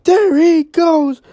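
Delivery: play-by-play call of an interception touchdown; building excitement. Real, not acted. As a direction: ride the return all the way to the end zone. Pick six run called live.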